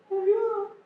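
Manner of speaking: very high, thin mock "Ayuda"; mock cry for help. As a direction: squeak out a feeble plea for help. Mock of a feeble call for help. (grito de ayuda fingido, muy agudo)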